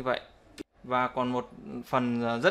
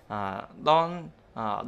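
ordinary delivery

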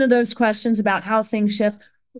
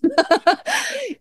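a bright burst of high laughter. Real, not acted. Mother laughing at herself about not caring for grades, high laugh.

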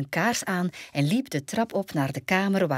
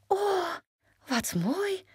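delighted exclamation; much slower than usual. A narrator's reading. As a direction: gasp in high-pitched delight. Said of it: "riep ze uit".

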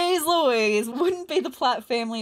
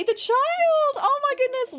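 excited squeal; 13 semitones higher than usual. Real, not acted. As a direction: stretch it out way up high with delight. Finally sees the new baby.

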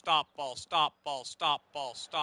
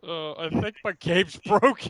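laughing; 4 semitones higher, 14 dB louder than usual. Real, not acted. Laughing at crashed game.

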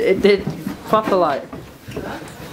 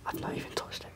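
whispering; 19 dB quieter, faster than usual. Real, not acted. Spooky moving object on ghost hunt.

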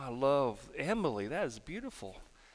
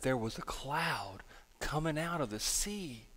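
whispered, suspenseful report; acted-out breathy secret; hushed; 3 semitones lower, 8 dB quieter than usual. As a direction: reveal it in an awed whisper. Friend reveals the cloud, building suspense.

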